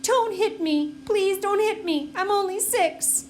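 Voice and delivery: high, pleading whimper, slowing down; crying plea. A narrator's reading. Scared little boy begging Elaine not to hit him, crying. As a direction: beg through tears not to be hit. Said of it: "Daniel started to cry".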